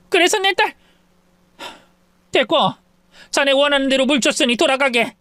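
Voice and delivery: put-on tearing into the drunk son-in-law; angry mother-in-law. (술주정하는 사위를 호되게 꾸짖는 장모)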